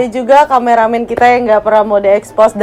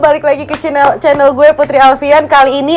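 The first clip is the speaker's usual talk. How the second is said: higher, energetic channel welcome; spirited intro; 5 semitones higher than usual. Shown as a demonstration. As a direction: welcome viewers back with enthusiasm. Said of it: "kita harus semangat".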